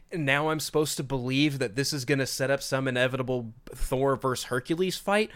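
normal talking voice

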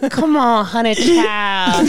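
high, drawn-out sweet-talk; hammed-up wheedling; comic cajoling. Defendant sweet-talking jury, acted.

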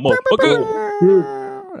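hype yell. Real, not acted. Hyping the countdown to the podcast crew with a drawn-out high shout. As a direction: stretch it out, way up high and long.

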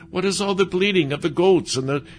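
demanding. A narrator's reading. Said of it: "Samuel demanded".

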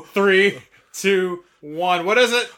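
loud, high final count; urgent countdown. Pretend. Host pressing co-host for a name, urgent countdown. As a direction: demand the answer as time runs out. Countdown ends, demanding an answer.

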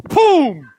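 high, punchy boom; imitated slam. Preacher imitating the winning slam, explosive sound effect. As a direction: burst out a sudden impact noise. Imitates giant's arm slamming down.

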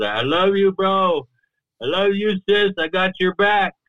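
put-on high, sing-song drunken love; happy drunk partygoer. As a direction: imitate tipsy partygoers hugging it out. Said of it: "You know how they talk like that?".

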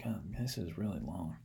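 hushed quiet aside; a quick whispered complaint. Real, not acted. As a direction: drop to a whisper mid-story.

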